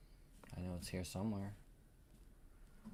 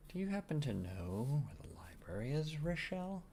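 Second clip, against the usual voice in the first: wheedling question; 8 semitones higher than usual. Acted. Asking a tavern stranger for directions, high wheedling lilt. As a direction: pitch swooping high into the name. Player acts out asking Rochelle.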